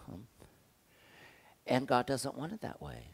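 ordinary talk

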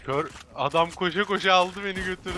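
loud, high, worked-up complaint; excited outburst. Real, not acted. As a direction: blurt it out indignantly. Complaining loudly about getting killed.

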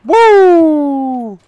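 loud high whoop; real cheering whoop; victory whoop. Real, not acted. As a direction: whoop in relief and triumph. Celebrates surviving close fight.

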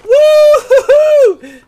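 loud, high train-whistle hype; drawn-out high whoops for the sub train; excited whoop. Real, not acted. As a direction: holler it long and high.

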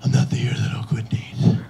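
hoarse, whispered heroic speech; Batman. Acted. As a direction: deliver a solemn speech in a raspy whisper. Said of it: "make a big Batman speech".

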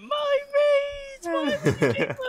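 mock sobbing "My Raze!" very high; crying gamer. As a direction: wail it like a crushed kid. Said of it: "like, he went".

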